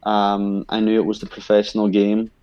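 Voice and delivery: usual speaking voice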